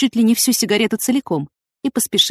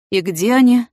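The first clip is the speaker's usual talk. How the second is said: doomed question, raised in pitch; resigned dread; 4 semitones higher, 5 dB louder than usual. A narrator's reading. Major asking where the suitcases are, resigned. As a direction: ask as if expecting the worst. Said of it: "обречённо спросил майор".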